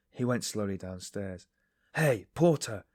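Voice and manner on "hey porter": loud, high call. A narrator's reading. Raskolnikov calling the porter across the gateway, loud. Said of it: "he cried in the gateway".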